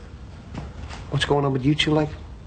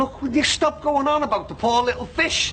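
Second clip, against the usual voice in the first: exasperated. Acted. Exasperated at being interrupted.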